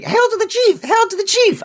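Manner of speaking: high squawky parrot cry, repeated; shrill parrot impression; squawking parrot. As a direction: screech it like a parrot. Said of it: "I have to say that every time".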